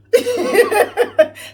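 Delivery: high, loud burst of laughter. Real, not acted. Vlogger laughs loudly at her devil punchline. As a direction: laugh hard at your own line.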